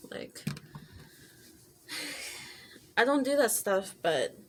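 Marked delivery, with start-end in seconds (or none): 1.9-2.5 short breathy sigh; weary sigh. Real, not acted. Woman recounting a fading friendship, weary sigh. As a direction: let out a sigh mid-story. Sighs while recounting falling-out.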